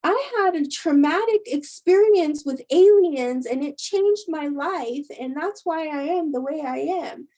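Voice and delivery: high, loud outburst that fades; upset retort. Retold or imitated. Jumpy girl finally answering the nosy friend, upset. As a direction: snap back, hurt and fed up. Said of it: "the girl got really upset".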